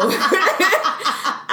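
high burst of laughter. Real, not acted. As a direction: crack up before telling the story. Starting the story, cracking up.

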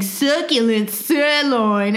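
slow, hammed-up Australian ad voice; fake Australian accent. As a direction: sell it slowly in mock Australian. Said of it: "That guy's definitely not really Australian. He'll be like".

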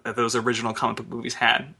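ordinary delivery

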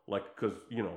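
ordinary talk, nothing marked

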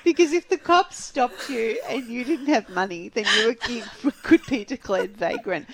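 high, breaking into giggles; laughing. Real, not acted. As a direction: talk while cracking up. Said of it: "Why are you laughing?".

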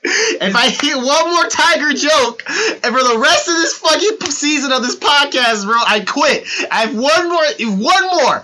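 fed up and shouting; mock angry rant. Mock threat to quit podcast.

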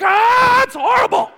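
high, loud cry of disgust through a laugh; disgusted yell. Retold or imitated. Watching a dog eat its vomit, laughing yell of disgust. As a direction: shriek like you just watched something gross. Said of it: "And you're like".

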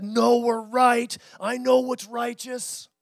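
loud, slow, self-righteous insistence; prideful youth, stretched out loud; proud young person. Retold or imitated. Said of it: "you still have that sense of pride".

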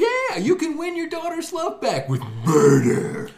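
high, slow, shouted movie pitch; mock trailer shout. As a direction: sell it like a hammy trailer.

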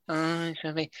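soft, moaning whine; whiny voice. Retold or imitated. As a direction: whine like a sulky fan. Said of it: "This is just my whiny voice".

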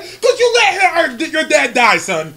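loud, drawn-out accusing scold; angry mom retort. Acted. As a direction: lay the blame on your son, loudly. Mom scolding son, acted again.

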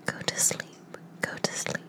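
plain reading voice

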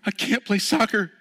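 put-on high, loud, slow crying boy; sobbing child. Boy crying that he can't play without mom, high slow wail. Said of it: "He's like".

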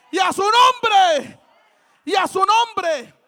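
full-force pulpit proclamation, punching each phrase; impassioned preaching shout. Real, not acted. Preacher declaring to the congregation that all is for Christ, impassioned shout.

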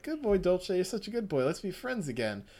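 mock-sweet sinister wheedling; coaxing madman. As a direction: lure the cat in with fake kindness. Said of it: "It's like".

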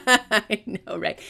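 loud, high, laughing agreement; amused. Real, not acted. Reader answering a fangirl tease, laughing. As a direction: agree through real laughter.

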